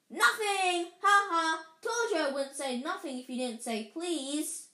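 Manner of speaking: high, loud crowing that eases off; gloating taunt. Acted. Poltergeist tricking Filch, gleeful gloating. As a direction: crow with mocking laughter.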